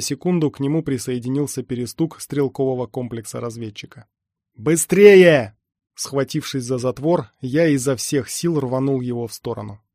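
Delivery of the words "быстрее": loud, higher, urgent shout; urgent yell. A narrator's reading. Everest firing at the swarm, desperate yell. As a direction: yell to hurry them up. Gunner hurries team under attack.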